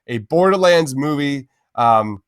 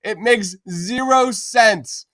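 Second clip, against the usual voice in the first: exasperated plea; 8 semitones higher than usual. Acted. Pleading rant at Disney.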